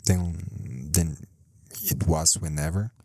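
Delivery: usual speaking voice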